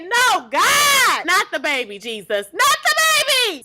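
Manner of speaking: high, loud pleading cry; retold panicked shout. Viewer pleading with the show not to kill the baby. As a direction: yell it like you're begging the TV. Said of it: "I said".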